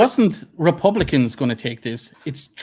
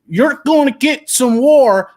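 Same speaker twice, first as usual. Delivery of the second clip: high, slow mock war cry; mock a blustering armchair soldier; drawn-out, put-on tough-guy threat; wannabe warrior; 9 semitones higher, 7 dB louder, slower than usual. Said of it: "who are like".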